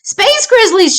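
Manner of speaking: high frightened wail. Acted. Little sister telling her brother what chased her, wailing. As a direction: wail it out in terror. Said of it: "she wailed".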